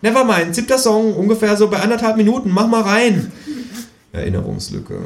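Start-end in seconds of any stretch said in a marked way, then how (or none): 0.0-3.3 high, fast kangaroo instruction. A narrator's reading. Kangaroo tells narrator which Nirvana song to play. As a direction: rattle off the track info eagerly.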